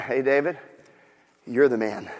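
low, soft, pointed delivery; quiet confrontation. Acted. Nathan confronts David with his guilt, quietly. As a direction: land the accusation quietly, after a pause.

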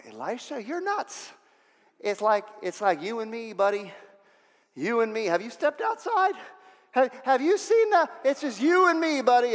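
mock-panicked servant, pitch leaping high; acted, high-pitched protest to a master; incredulous servant. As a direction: rise into squeaky disbelief. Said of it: "I'm the sermon, I'm going".

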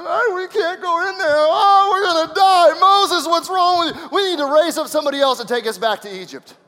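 loud, high, panicky wail; hammed-up complaint of a frightened crowd; mock grumbling. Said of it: "began to grumble and complain".

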